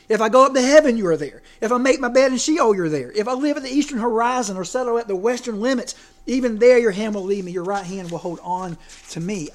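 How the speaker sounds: impassioned scripture reading, peaks loud; psalm reading. Acted. Preacher reading the psalm aloud, building in force. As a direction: read the psalm with swelling fervour. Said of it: "Listen to the psalmist as he says it".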